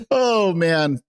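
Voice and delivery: normal talking voice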